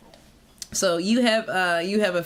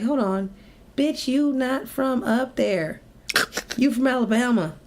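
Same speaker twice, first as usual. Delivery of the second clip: high, slow mock self-scolding; scolding herself; 5 semitones higher than usual. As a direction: tease yourself in a slow, sassy scold. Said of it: "and I'm like".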